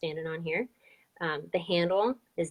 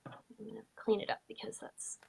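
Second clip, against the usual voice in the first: whispered aside; 4 semitones higher, 8 dB quieter than usual. Real, not acted. Muttered aside while tidying sketch.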